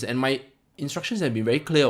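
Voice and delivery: normal talking voice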